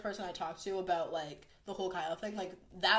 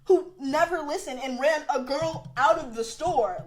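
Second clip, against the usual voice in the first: loud, higher-pitched retold outburst; exasperated protest; 5 semitones higher, 7 dB louder than usual. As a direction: build in disbelief, drawing out the end. Retold protest at being left.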